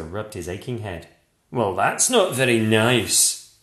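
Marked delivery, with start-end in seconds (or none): 1.5-3.6 hurt monster. A narrator's reading. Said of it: "he said".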